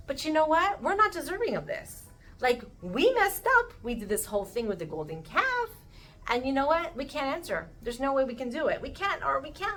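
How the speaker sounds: high, loud, agitated protest; hyping themselves up. Acted. Scouts talking themselves out of entering, worked up. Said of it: "Hyping themselves up in their minds".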